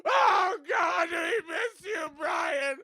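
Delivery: high, slow, wailing parody of a tearful dad; hammed-up crying speech, cracking high and loud; mock grieving eulogy. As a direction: overdo the sobbing tribute for laughs. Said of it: "it's just like".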